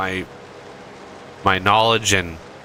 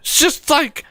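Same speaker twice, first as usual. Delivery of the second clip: high laugh spilling into the words. Real, not acted. Laughing in disbelief while telling a friend the story.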